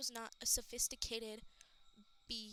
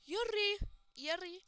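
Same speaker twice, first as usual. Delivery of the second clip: calling out; 8 semitones higher, 8 dB louder, slower than usual. Acted. Loud calling out.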